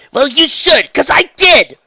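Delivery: high, loud heated shout; angry retort. A narrator's reading. Raindrops argues with Trixie, in anger. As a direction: shout the guilt back at her. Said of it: "beat her wings a few times in anger".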